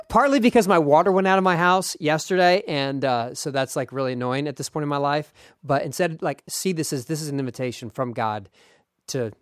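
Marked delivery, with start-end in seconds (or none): none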